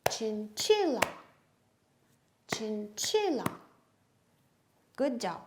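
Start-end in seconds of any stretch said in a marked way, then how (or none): 0.1-3.4 chinchilla in claps, CHI strongest; stress on CHI. Shown as a demonstration. Teacher clapping together with children, stress on CHI. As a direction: hit CHI in chinchilla on the clap. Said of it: "Let's clap the word together".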